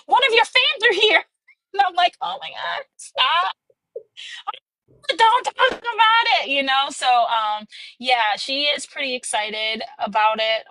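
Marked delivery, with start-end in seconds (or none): 0.1-1.2 high, excited imitation of a fan; excited announcement. Coworker tells author's mom about a fan, excited. 2.2-6.5 bashful protest. Retold or imitated. Bashful reply to being called famous.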